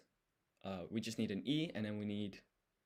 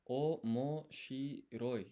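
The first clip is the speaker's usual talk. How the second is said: omoshiroi drawn out slowly, syllable by syllable; slow practice repeat; 3 semitones higher, much slower than usual. Shown as a demonstration. Tutor slowly sounding out omoshiroi for the viewer. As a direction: stretch omoshiroi out, slowly and carefully. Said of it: "drawn-out practice repeat".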